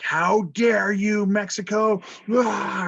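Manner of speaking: high, slow mock outrage; outraged Americans. Teacher voicing outraged Americans to class, high slow mock indignation. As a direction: play the offended American public, drawn out and high. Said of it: "Americans are like".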